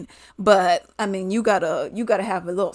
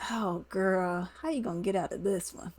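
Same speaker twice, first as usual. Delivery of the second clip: muttered self-talk; 10 dB quieter than usual. Retold or imitated. Talking to herself on seeing her date, muttered. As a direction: mutter it privately, sizing up an escape.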